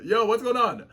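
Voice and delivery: ordinary talk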